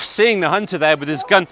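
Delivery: usual speaking voice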